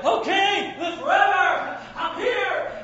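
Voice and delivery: shouted high and loud, jubilant; triumphant shout. Acted. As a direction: call out victorious from the lions' den. Daniel calls out from den.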